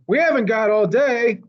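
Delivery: high, loud impatient snap. Acted. Mac yells at the stubborn elephant, impatient snap. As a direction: hurry them along, annoyed.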